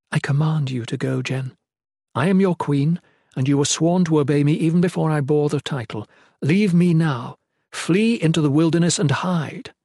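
imperious queen, urgent; queen, commanding. Acted. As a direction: order a loyal subject away.

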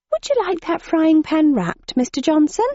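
shop lady voice. A narrator's reading. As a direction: ask sweetly like a shop clerk. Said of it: "asked the lady serving".

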